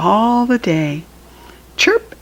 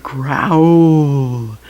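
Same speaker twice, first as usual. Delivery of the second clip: tiger growl; 3 semitones lower than usual. Pretend. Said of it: "this is the tiger".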